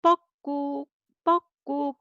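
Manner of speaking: high two-note bird call, 뻑꾹; Korean cuckoo call. Shown as a demonstration. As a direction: imitate a cuckoo for the class. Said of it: "This is a sound".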